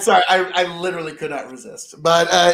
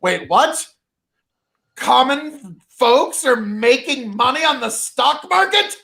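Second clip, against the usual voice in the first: higher and shouted, playing a scandalized rich man; mock outraged elite; 6 semitones higher than usual. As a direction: ham up a panicking hedge fund boss. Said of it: "the hedge fund and the elites are all like".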